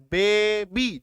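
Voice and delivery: "Baby" drawn out as बे, बी; teacher sounding out बे-बी in "baby"; syllable by syllable. Shown as a demonstration. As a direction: slowly split "baby" into two beats. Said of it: "दो बार बोला ना".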